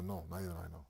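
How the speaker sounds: king repeating the news in quiet wonder; to himself, nearly silent. Retold or imitated. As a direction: let the repeat fade into a near whisper. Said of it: "Nabi Sulaiman says".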